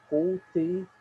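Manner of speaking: "culti" with culta and e fused into one syllable; elided vowels. Shown as a demonstration. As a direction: run "culti" together as one sound. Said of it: "vai acontecer a mesma coisa".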